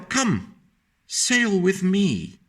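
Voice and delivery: high, slow gentle invitation; kindly whale, high and unhurried; inviting whale. Acted. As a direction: call the snail aboard, drawn out. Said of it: "said to the snail".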